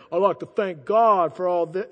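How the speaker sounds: hammed-up thank-you from the podium; mock acceptance speech. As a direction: put on a pompous winner's thanks. Mimicking award winners' acceptance speeches.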